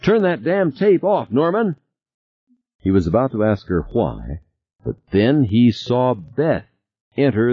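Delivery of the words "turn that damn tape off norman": much higher, sharp and urgent; urgent demand. Acted. Beth demands Norman kill the tape, urgent and high. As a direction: order him to stop, rising panic.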